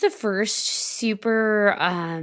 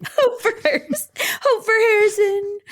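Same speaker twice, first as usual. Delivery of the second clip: mock charity slogan; 3 dB louder than usual.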